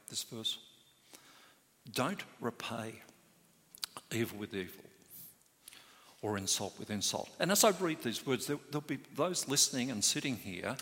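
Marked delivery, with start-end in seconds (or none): 0.1-7.3 softer, unison, as if under a mask; quiet together. Shown as a demonstration. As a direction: say the verse quietly with the congregation. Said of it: "say that quietly together".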